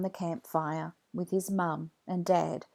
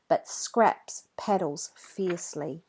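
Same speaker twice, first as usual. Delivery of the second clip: usual speaking voice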